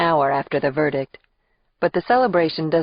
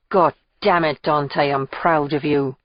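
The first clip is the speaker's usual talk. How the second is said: usual speaking voice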